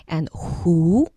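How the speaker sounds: ordinary delivery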